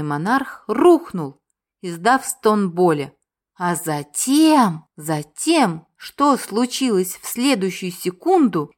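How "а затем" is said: drawn-out and weighty; slow dramatic pause. A narrator's reading. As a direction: stretch it, then pause.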